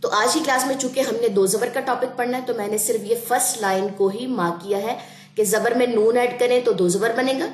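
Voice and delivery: ordinary delivery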